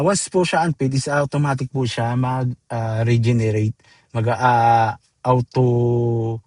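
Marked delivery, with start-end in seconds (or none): none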